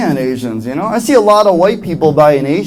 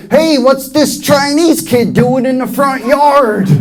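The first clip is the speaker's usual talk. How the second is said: raised, drawn-out grandpa holler; higher, slow mock-grumpy old man; gruff grandpa; 6 semitones higher, slower than usual. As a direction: imitate a rude grandfather calling across the yard. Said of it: "the Grandpa's just like".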